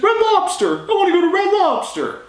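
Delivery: imitating Jen. Jen begging to go to Red Lobster, high mock whine. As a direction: squeak it out like a pleading child. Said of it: "She's like".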